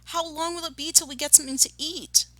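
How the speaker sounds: high plaintive youngster voice; whiny little fox; hungry fox cubs complaining. A narrator's reading. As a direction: pitch it high and plead. Hungry small foxes complain to parents.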